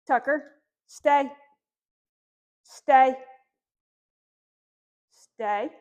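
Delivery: loud, firm stay commands to the puppy. Shown as a demonstration. Trainer firmly telling the puppy to stay. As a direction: say it firmly, like a stop sign. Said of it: "in a pretty firm tone".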